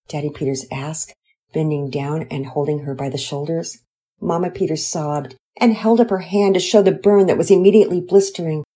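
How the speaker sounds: plain reading voice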